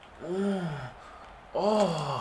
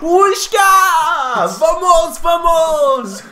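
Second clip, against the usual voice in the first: excited shout; 10 semitones higher, 9 dB louder, much slower than usual. Real, not acted. Celebrating a goal in the game.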